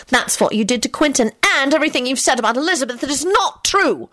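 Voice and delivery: snappish, rising indignation; snapped. A narrator's reading. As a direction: fling the accusation at her. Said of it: "she snapped".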